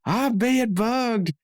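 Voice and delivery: high, slow, drawn-out paranoid whine; Dale Gribble impression. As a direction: stretch it out in a high, panicked nasal Dale voice. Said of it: "And he goes".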